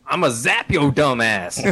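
cop slang. Pretend. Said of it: "You know what the answer is?".

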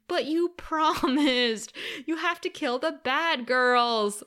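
put-on slow, drawn-out child's complaint; whining children. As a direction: plead like a put-out little kid. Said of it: "the two kids are literally standing".

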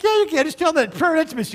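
high, eager mock insistence; eager pleading. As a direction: brightly push her to send it. Pastor pleading with wife.

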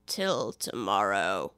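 very low, slow, indifferent drawl; a bored servant, low and slow; slow deep footman. Acted. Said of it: "continued in the same tone".

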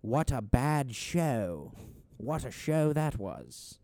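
put-on slow, stretched-out British accent; very British understatement, drawn out. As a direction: try a stiff British accent, lingering on words. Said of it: "Like, a British person leaving that is like".

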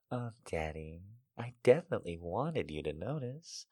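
very soft, flirtatious reply; flirty. A narrator's reading. Woman to a lover who cannot see her eyes, flirty and hushed. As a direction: flirt with the voice, kept very quiet. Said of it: "I have to flirt with my voice".

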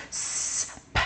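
long hiss then a popped p; hissing s, then a quick lip pop; alternating soft s and popped p; s p drill. Shown as a demonstration. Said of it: "kind of pop my lips".

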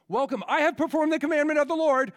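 loud, high, fast overeager greeting; Saul's eager welcome. Retold or imitated. King boasting to the prophet, loud and high. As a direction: gush it out like a guilty king covering up. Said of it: "he's like".

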